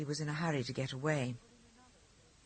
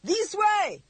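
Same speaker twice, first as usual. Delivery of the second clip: high, loud panicked shriek; 6 dB louder than usual. A narrator's reading. Frightened driver shrieking at the reckless pilot. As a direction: shriek it in terror. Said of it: "he shrieked".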